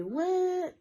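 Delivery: put-on high baby wail; mock baby cry. Imitating her newborn's first cry, high.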